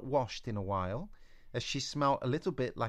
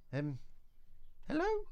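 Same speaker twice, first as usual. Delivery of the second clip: a timid, rising hello into the dark; calling out unsure who's there; nervous call; 4 dB quieter than usual. Acted. Eliza calls into darkness.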